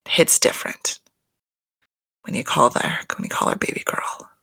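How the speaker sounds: creeped-out whisper; hushed, disgusted whisper. Real, not acted. Vlogger whispers her disgust about baby girl to viewers.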